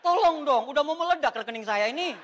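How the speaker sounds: loud, high comic begging; hammed-up cry for help; mock desperate plea. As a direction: plead loudly as a joke, laugh close by. Comic plea to stop the money.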